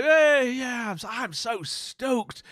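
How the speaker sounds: high, drawling mock American; hyped-up bad American accent; attempted American accent. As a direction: gush slowly in a shaky American accent. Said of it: "I can't do the accent".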